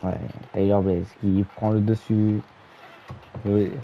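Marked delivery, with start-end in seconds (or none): 0.0-2.4 live play-by-play call; wrestling commentator. Acted. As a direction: narrate the match as it happens.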